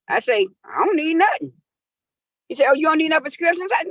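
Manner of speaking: ordinary talk, nothing marked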